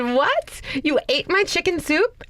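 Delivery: can't believe he ate it; outraged disbelief. Retold or imitated. Wife retells discovering her soup was eaten. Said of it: "I said".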